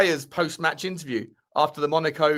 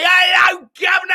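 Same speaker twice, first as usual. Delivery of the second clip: shouted, much higher cod Cockney greeting; mock Cockney; 11 semitones higher, 9 dB louder than usual. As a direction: ham up a West Ham Cockney hello. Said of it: "he'll be talking like this".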